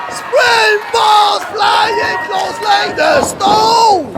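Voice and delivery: excited high play-by-play shouting; wrestling commentator. Acted. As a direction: call every move like a ringside hype man.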